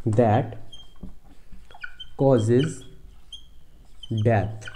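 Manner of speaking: long pauses between that, causes and death; isolated words, death falling low; word-by-word dictation. Shown as a demonstration. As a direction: dictate each word on its own, as if writing it. Teacher dictating while writing.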